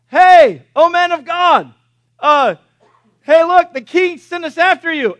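loud, high holler up a hill; calling out. Acted. Captain shouting up to Elisha on the hillside, hollered summons. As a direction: hail someone far above you, acting it out.

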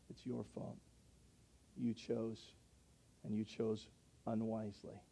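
soft, hushed, low mock accusation; mock blaming voice. Sinner blaming God for his own choice, hushed mock voice. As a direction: lightly voice a sulky blamer, hushed. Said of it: "look at the Holy of Holies and say".

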